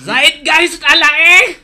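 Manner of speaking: loud, high, drawn-out German Batangueño shout; German Batangueño accent. Shown as a demonstration. Performing a requested mash-up, German Batangueño accent. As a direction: roar slowly like a comic German from Batangas. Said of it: "German Batanggenyo".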